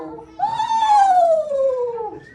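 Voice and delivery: very high, loud screechy woos, held long; screech owl call. Retold or imitated. Showing the correct screech owl call, very high screechy woo. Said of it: "It says".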